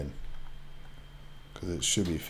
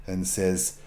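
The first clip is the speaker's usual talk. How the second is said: ordinary talk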